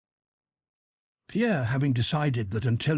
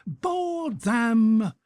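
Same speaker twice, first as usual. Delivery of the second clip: battle cry; 11 semitones higher than usual. A narrator's reading. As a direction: charge them with the words. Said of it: "brandishing the pistol".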